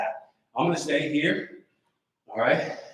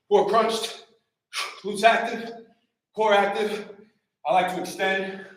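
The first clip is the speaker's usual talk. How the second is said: energetic live trainer calls, a bit raised; workout coach cueing; 3 semitones higher than usual. Acted. Coach calling out moves to a live workout class, energetic. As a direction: drive the class through each rep.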